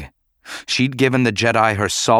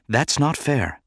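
higher, mild reproach; gentle objection; 5 semitones higher, slower than usual. A narrator's reading. Padme objecting to Bail's derision, mild reproach. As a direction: object without raising your volume.

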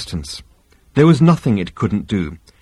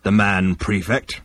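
slow, slightly lowered alien voice; slow rumbling Vogon; 2 semitones lower, 3 dB quieter, slower than usual. A narrator's reading. Vogon captain naming the occupants, slow rumble. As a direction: report it slowly and heavily.

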